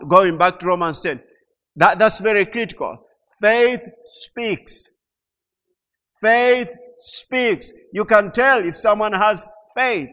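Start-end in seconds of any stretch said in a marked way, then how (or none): 3.4-4.9 loud emphatic declaration of faith; preached proclamation. Real, not acted. Preacher declaring to church, loud proclamation. 6.2-7.7 loud emphatic declaration of faith; preached proclamation. Real, not acted. Preacher declaring to church, loud proclamation.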